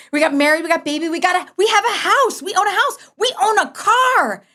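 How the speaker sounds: high, bright-eyed listing, laughing at the end; giddy rundown of grown-up life; excited amazement. Retold or imitated. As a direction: pile up each milestone with growing glee. Excited list of adult milestones.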